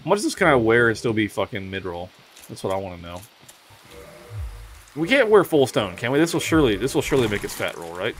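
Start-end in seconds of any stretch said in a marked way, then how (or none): none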